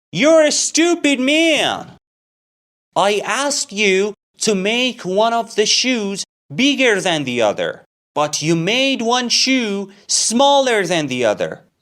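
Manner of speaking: high, loud angry scolding; enraged complaint. Acted. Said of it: "Mahdiar is in a rage".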